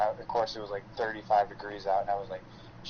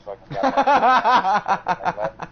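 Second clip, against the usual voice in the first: laughing; 12 semitones higher, 12 dB louder, much slower than usual. Real, not acted. Laughs finishing the cop story.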